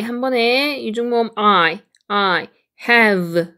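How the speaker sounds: ordinary talk, nothing marked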